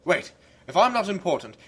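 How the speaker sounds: usual speaking voice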